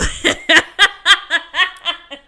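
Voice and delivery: high, loud helpless laugh; a loud laughing fit; loud burst of laughter. Real, not acted. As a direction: crack up loudly. Still laughing at joke.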